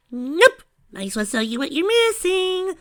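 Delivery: very high, loud, teasing; teasing squeal. A narrator's reading. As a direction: tease in a squeaky shout.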